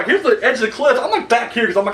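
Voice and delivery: ordinary talk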